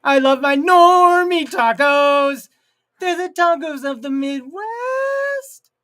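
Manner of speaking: high, loud, slow put-on yokel voice; hammed-up yokel. Streamer mocking bland Midwest tacos in a hammed-up yokel voice. Mock hick voice about tacos.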